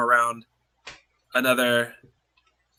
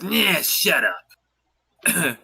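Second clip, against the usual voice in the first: very slow dismissive shutdown; much slower than usual. Acted. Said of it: "And she goes".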